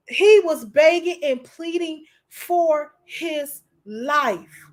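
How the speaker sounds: slow, grief-struck disbelief with long pauses; emotional. Real, not acted. Host overcome while retelling a man's killing, slow and emotional. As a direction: let each phrase land heavily, fighting real emotion. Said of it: "I don't know why I'm so emotional".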